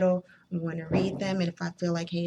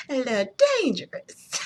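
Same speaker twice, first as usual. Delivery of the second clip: higher, laughing tease; laughing mock warning; 6 semitones higher, 5 dB louder than usual. Real, not acted. Laughing mock warning about old poems.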